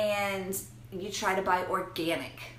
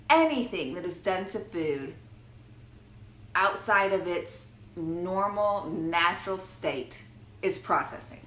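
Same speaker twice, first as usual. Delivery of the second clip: normal talking voice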